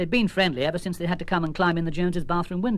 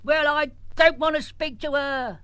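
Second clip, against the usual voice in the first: put-on slow, higher cranky old man; grumpy old uncle; 9 semitones higher, slower than usual. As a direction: drag it out, crotchety and stubborn.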